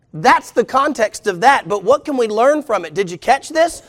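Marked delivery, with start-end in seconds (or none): none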